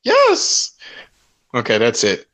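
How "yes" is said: very high falsetto yes; high-pitched cook impression. Asked to do it again, answers in high impression. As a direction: squeak it out in the cook's voice.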